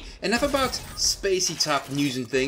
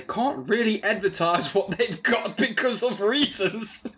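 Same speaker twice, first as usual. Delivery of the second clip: laughing; 7 semitones higher than usual. Real, not acted. Laughing at his own joke.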